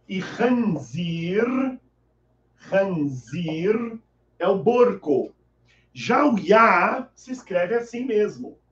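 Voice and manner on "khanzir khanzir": "khanzir" stretched out very slowly; very slow Arabic demo. Shown as a demonstration. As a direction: draw out "khanzir" for learners. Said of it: "Como escrever em árabe?".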